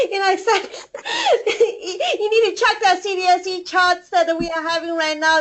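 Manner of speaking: giggling through the words; laughing high with delight. Real, not acted. Overjoyed on a business call.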